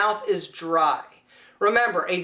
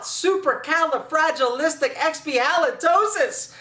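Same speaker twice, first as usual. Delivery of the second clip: playful sing-song; 6 semitones higher than usual. Acted. Made-up silly word.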